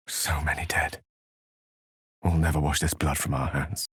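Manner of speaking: hushed, voiceless, heavy; grim whisper. Acted. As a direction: breathe it out in shock. Grim whisper over the dead.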